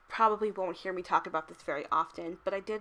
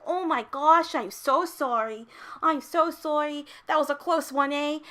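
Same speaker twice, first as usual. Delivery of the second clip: overly polite Canadian; 6 semitones higher, 7 dB louder than usual. Retold or imitated. Other driver shouting apologies across cars, loud higher sorry. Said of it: "he's like".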